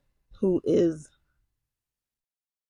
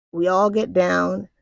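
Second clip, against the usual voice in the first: usual speaking voice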